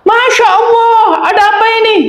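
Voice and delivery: high, shrieking cry of alarm, slowed; startled scream. Retold or imitated. Wife finds a thief in her house, screaming in alarm. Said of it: "Wanitanya teriak".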